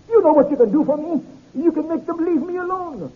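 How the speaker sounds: high, desperate, frightened plea; terrified. Acted. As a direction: plead in a high, panicked voice. Terrified man begs for help.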